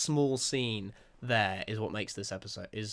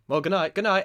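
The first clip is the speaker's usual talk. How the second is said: casual goodnight quote; 8 semitones higher, 7 dB louder than usual. Retold or imitated. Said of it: "they're like".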